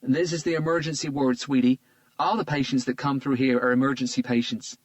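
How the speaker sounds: put-on gentle Southern US accent, patient and kind; tender southern accent. A narrator's reading. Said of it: "tender voice with a very strong southern accent".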